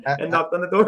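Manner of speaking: cracking up at his own prank story; laughing. Real, not acted. Telling a buddy about the flag prank, laughing.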